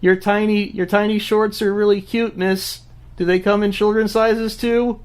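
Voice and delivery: high-pitched imitation of a curious kid; child's voice. Children stopping by in the park to question two adults, mock kid voice. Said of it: "And then children stop by".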